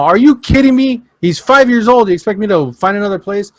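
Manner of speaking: high, loud incredulous protest; mock outraged parent; indignant retort. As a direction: push back at the staff. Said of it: "I'm like".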